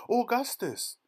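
very high, loud alarmed call; shrieky storybook mother calling out; mother's shout. A narrator's reading. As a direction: shout for your son in alarm. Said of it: "shouted Missus Gloop".

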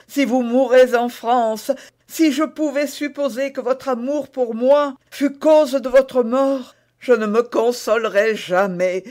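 a high, urgent, desperate queen; pleading queen. A narrator's reading. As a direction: beg him to go, voice climbing.

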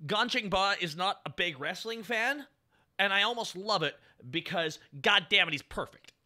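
usual speaking voice

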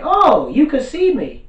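high, drawn-out imitated oh; mock surprise. Main bad guy realizes a kid sees him, surprised. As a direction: stretch it high, playfully taken aback. Villain surprised at being seen.

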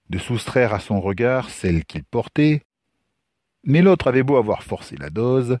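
ordinary delivery

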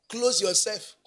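ordinary talk

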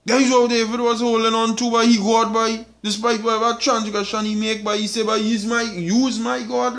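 high, loud, driving sermon voice; fiery preaching. Acted. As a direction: preach it out at full force to the congregation. Preaching at full force.

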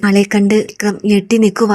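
usual speaking voice

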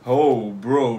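usual speaking voice

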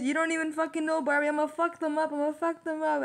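amused imitation of someone itching to fight; stubborn. Reader imitating a defiant Virgo to Barbie, mock stubborn. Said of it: "you're so stubborn".

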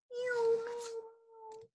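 very high, very soft meows; tiny sleepy kitten mews; soft high kitten mewing. Pretend. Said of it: "kittens softly creep".

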